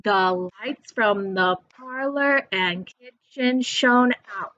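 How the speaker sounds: slow, measured beat on LIGHTS, PARLOR, KITCHEN, OUT; drawn-out line with four clear stresses; stressed syllables marked. Shown as a demonstration. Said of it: "Those are the stresses".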